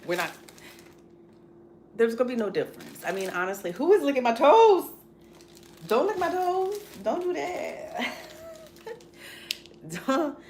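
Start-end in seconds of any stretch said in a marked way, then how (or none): 3.7-10.4 high, loud startled protest; real surprise, pitched way up; startled squeal. Real, not acted. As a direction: yelp at the dog licking her toes. Pet licking her toes.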